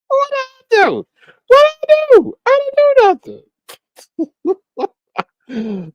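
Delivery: very high falsetto squeal; squealing innocence. Pretend. Streamer mock protesting to a rival, falsetto squeal.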